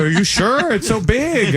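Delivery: high, worried, whiny; high anxious whine. Retold or imitated. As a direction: ask it nervously. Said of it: "I go".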